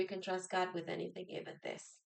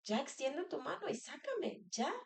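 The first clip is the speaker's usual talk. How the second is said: impatient plea; 4 semitones higher than usual. Retold or imitated. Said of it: "y digo yo".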